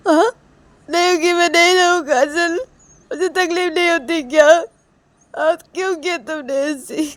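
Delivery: high, wailing hurt reproach; acted tearful protest. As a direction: plead through tears, upset and accusing. Acted Reshma crying, hurt by Kajal.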